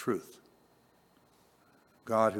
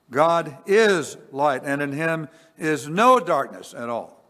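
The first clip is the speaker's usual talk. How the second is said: much louder, slow "God is light"; emphatic proclamation; 4 semitones higher, 7 dB louder, slower than usual. Acted. Preacher proclaiming to congregation, loud and slow. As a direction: proclaim it as the heart of the sermon.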